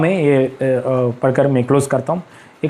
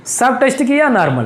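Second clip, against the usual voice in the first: ordinary delivery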